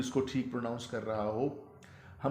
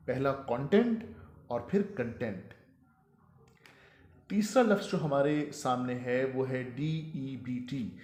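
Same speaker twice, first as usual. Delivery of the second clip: usual speaking voice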